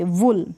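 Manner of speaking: "वुल" with a short clipped u. Shown as a demonstration. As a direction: cut the vowel of "वुल" short. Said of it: "क्या पढ़ेंगे".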